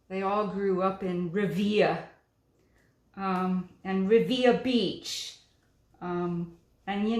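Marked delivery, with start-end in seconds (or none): none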